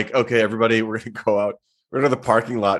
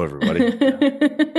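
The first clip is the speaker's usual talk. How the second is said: [name]; slower than usual. Retold or imitated.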